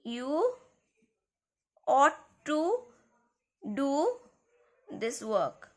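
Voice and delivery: "You ought to do this work" with each word set apart by pauses; emphatic, word by word. Shown as a demonstration. Teacher shows the class emphasis, slowly and deliberately. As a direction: lean on "ought" and space out every word. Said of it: "I'm putting an emphasis".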